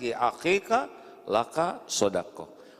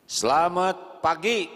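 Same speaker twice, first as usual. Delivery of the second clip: greeting voice; 6 semitones higher, 5 dB louder, slower than usual. Acted. Acted greeting, louder, slower.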